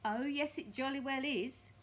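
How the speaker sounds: loud, high, emphatic retort; Mr Twit insisting. Acted. Mr Twit contradicting his wife, loud gloating insistence. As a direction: override her at full volume.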